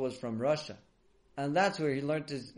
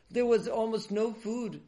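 plain reading voice

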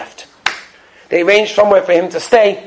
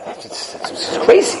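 breathless disbelief; 13 semitones higher, 5 dB quieter than usual. A narrator's reading. Astonished aside.

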